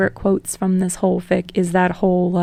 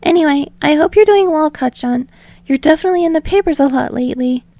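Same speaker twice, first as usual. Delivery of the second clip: higher, louder eager Deku voice; rambling; 8 semitones higher, 3 dB louder, faster than usual. Acted. Deku rambling to Bakugo on the phone, higher and eager. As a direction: babble on nervously without a breath.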